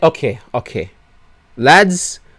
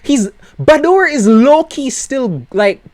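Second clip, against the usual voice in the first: exasperated whine; 10 semitones higher, 4 dB louder than usual. Real, not acted. Exasperated rant about Bador.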